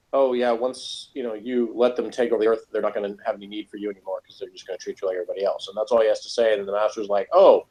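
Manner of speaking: usual speaking voice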